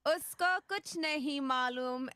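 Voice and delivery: put-on high, monotone robot-child voice; high robotic Hindi dub; robot girl. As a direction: do the badly dubbed robot girl. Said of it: "talk like this the entire time".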